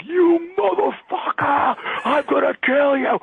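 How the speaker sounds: high, drawn-out mock war cry; mock furious threat. Rock star bursting in on radio host, mock fury. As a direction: stretch the curse out high, as a play attack. Acted attack on Howard Stern.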